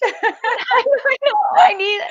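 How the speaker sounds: high laughing shout; real laughter breaking through the words; squealing with laughter while admitting it. Real, not acted. Laughing at the ribs joke.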